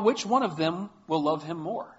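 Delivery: ordinary talk, nothing marked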